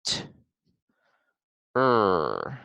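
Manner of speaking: short "T", pause, drawn-out "er"; sounding out. Shown as a demonstration. Teacher finds two sounds in ter, sounding out. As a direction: split ter into "T" and a long "er". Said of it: "only two sounds".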